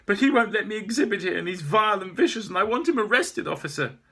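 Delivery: high, loud indignant complaint; aggrieved artist shouting at police; shrill accusation. Acted. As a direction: demand it furiously. Maeve accusing the owner.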